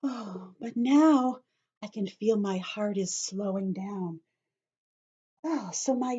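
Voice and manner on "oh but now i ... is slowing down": ordinary talk, nothing marked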